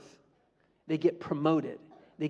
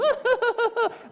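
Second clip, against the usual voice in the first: gleeful inner laugh; 5 dB louder than usual. Retold or imitated. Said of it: "inside he's going".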